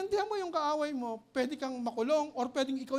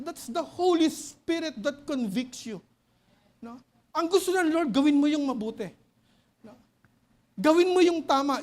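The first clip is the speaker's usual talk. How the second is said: booming sermon delivery; full-force preaching; 9 dB louder than usual. Acted. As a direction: drive each point home from the pulpit. Preacher building to loud full-force points.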